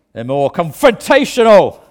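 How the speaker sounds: a sudden loud preacher's blast, lifted in pitch; shouty preacher. Shown as a demonstration. Preacher illustrating a shouty pulpit style, loud blast. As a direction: show the confrontational shouter full force. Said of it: "Some preachers are more shouty".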